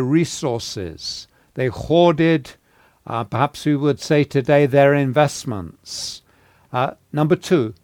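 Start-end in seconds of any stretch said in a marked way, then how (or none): none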